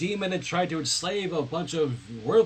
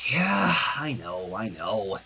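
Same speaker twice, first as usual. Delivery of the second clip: slow resigned admission; weary sigh; 9 semitones lower, slower than usual. Acted. Sunset wearily admitting her friends are right, slow and resigned. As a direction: let it out tired and resigned. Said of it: "Sunset sighed".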